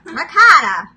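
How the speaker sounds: ricotta, shouted New York style as rigotta; a big proud Queens ricotta; Queens accent. Shown as a demonstration. Vlogger hams up her New York ricotta, loud. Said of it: "tingle when I say ricotta".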